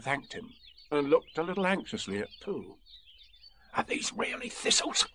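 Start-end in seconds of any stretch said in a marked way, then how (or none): 3.7-5.1 anxious, high creature whisper. A narrator's reading. Tiger checking with the bear, in an anxious whisper. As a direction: whisper it doubtfully. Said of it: "he whispered".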